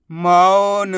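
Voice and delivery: मौन as one whole word; sounds joined. Shown as a demonstration. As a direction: blend मौ and न without a pause. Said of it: "उच्चारण करके आपस में लाके".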